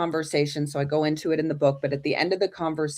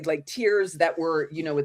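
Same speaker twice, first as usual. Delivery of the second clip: normal talking voice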